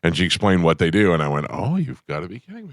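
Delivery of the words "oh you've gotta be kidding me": high, soft disbelief; half-laughing can't believe it; mock incredulous gasp. Hearing the poo dollar explained.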